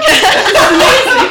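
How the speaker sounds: high, loud and amazed; excited. Retold or imitated. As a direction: gush with excitement. Retelling fan moment.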